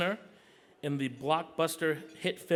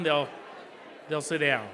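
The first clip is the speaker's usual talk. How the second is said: ordinary talk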